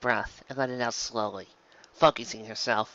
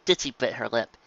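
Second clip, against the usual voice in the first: ordinary delivery, nothing marked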